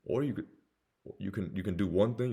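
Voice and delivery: ordinary delivery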